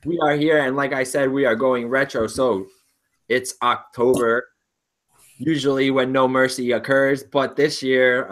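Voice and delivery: upbeat show host delivery. Acted. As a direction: present it like a host. Hosting show intro.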